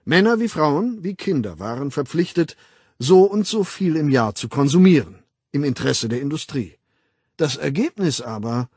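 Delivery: emphatic public lecturer; lecturing World Controller. Acted. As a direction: hold forth with authority, punching key words. Weltaufsichtsrat doziert. (dozierend, nachdrücklich)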